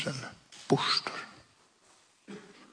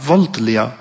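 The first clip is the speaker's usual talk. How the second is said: ordinary delivery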